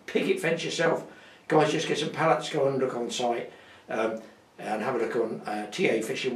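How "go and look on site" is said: ordinary talk, nothing marked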